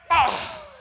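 sudden high yell mid-jump; loud yell. Real, not acted. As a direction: let out a big high yell. Leaping off the swing.

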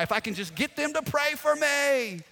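high-pitched, yearning whine for prayer; hammed-up longing; mock-desperate plea. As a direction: act out an over-eager believer begging. Voicing a desperate prayer seeker.

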